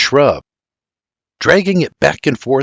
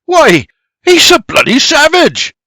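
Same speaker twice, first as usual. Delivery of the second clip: high, loud aghast outburst; shocked gasp; 6 dB louder than usual. A narrator's reading. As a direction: exclaim in horrified shock. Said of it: "Geoffrey gasps".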